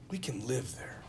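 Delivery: soft reflective repeat. Shown as a demonstration. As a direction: let it sink in, quietly. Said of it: "And we can live there".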